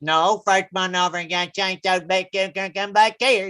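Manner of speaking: garbled thick Southern gibberish; run-together Southern mumbo jumbo; imitating his brother. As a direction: mimic an unintelligible Southern kid. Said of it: "I just imitated his brother".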